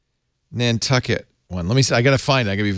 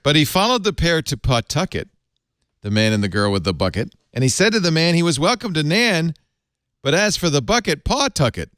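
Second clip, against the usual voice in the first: limerick recital; 4 semitones higher than usual. Shown as a demonstration. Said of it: "this is the original".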